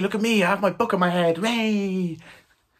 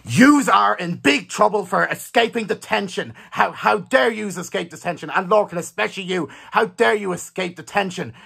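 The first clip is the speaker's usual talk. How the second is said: angry telling-off. Acted. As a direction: tell off two runaway pupils in mock anger. I'm very angry.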